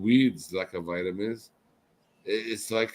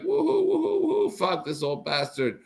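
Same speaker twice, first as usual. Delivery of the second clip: high mock whoops of rowdy kids; mocking kids whooping. Imitating kids whooping at an old worker, much higher. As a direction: squeal it like rowdy kids jeering. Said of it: "They're screaming".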